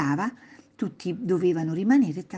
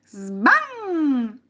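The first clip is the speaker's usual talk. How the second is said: higher, loud, ringing sbam; repeated door slam; 10 semitones higher, 5 dB louder than usual. Acted. Boy exclaiming over banged door, high loud slam. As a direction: slam it high and let it ring. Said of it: "esclamò di nuovo".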